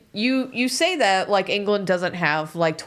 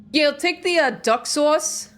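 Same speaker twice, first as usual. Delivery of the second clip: high, drawn-out Long Island "duck sauce"; thick Long Island accent; 10 semitones higher, 4 dB louder than usual. Retold or imitated. Imitating her friend's thick Long Island accent. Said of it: "This is the thickest your accent's ever been".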